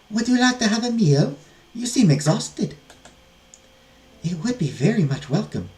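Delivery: high-pitched, formal character voices; courteous nun and maidens. Acted.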